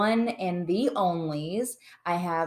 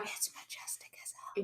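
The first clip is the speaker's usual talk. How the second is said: awed whisper; 15 dB quieter than usual. Real, not acted. Awed gasp at unicorn.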